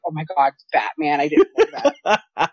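slow, sarcastic imitation through a laugh; mock dumb moviegoer. Mocking the moviegoers who thought it was news, slow and laughing. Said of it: "you be stupid and saying".

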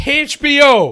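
high, loud disbelief; can't believe what you heard; astonished. Real, not acted. Shocked at the news.

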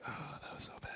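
breathy whisper of regret; whispering inner worry; whispered self-doubt. Retold or imitated. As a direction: mutter it to yourself in dismay. Imagined self-doubt thought.

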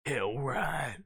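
low, firm "Hell right"; with authority. Retold or imitated. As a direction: say "Hell right" flat and certain. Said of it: "with authority".

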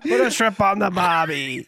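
slow, hammed-up Aussie cliché; mock Australian. Friend mocking another friend's Australian slide. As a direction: lay on the Aussie tourist line. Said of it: "goes Australian".

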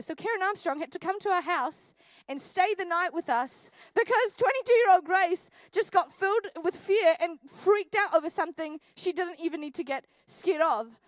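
ordinary talk

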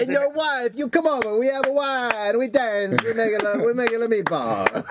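hammy Italian host offering food; a hammed-up Italian accent; cartoon Italian accent. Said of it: "my idea of Italy is so fucking cartoony".

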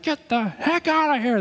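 high, drawn-out mock panic; slow, high-pitched freaked-out outburst. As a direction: imitate spooked people throwing someone out. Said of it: "they freaked out".

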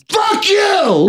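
shrill high shout; Jeff yelling. Retold or imitated. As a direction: scream it high and furious. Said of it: "Hangs up on me".

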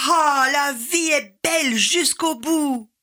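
slow exultant shout of Ah, la vie est belle; joyous shout. Acted. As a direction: shout it out to the sky. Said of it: "Il hurle".

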